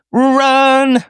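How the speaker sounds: high loud yell of run; shouted warning. Pretend. Host yells at the viewer to flee, loud.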